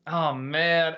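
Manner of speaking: ordinary delivery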